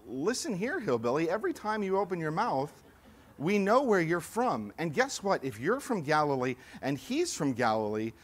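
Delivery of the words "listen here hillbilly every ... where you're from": taunting accusers. Acted. Bystanders taunting country boy Peter.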